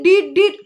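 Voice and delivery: high, loud beeping dit dit; beep sound. Retold or imitated. Streamer imitates a beep for the audience, high and loud. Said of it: "Gue nanti bunyinya dit".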